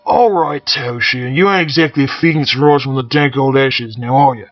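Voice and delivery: put-on loud sneering Cockney accent. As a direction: sneer back at the pilot. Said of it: "offer the pilot a sneer".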